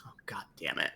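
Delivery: whispered, under the breath; muttered curse. Acted. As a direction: curse quietly to himself, annoyed. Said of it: "and just goes".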